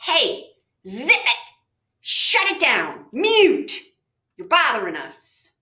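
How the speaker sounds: mock-stern silencing; thinking voice scolding. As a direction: shut down a noisy pest in short commands. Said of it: "it's the thinking voice that's going to point out".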